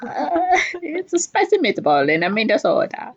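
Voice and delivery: laughing mock Italian Minnesota accent. Shown as a demonstration. Cohost showing off so many different sounds, Italian Minnesota accent. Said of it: "That's my Italian Minnesota accent".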